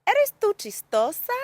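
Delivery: "¿Eres tú chistosa?" very high and loud, as a question; exaggerated high question melody on "¿Eres tú chistosa?". Shown as a demonstration. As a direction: model the asking tune on "¿Eres tú chistosa?". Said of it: "listen to my tone".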